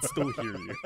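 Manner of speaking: very high, squealing laughter in the voice; high-pitched giggly insistence; laughing protest. Real, not acted. Laughing protest at muffled mic talk.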